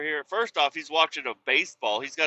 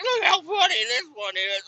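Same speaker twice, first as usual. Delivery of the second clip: very high, put-on silly voice; mocking the New York guy; 14 semitones higher than usual. Imitating the guy showing him AI videos, very high and silly. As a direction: squeak it out like a giddy goof. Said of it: "He's like".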